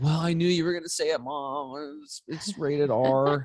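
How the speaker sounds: put-on sheepish teen. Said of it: "And you're like".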